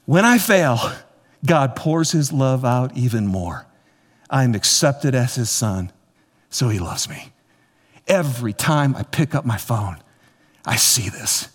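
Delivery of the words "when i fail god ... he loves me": measured read-aloud declaration. Real, not acted. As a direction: read it as a personal creed, pausing between phrases. Reads declaration off his phone.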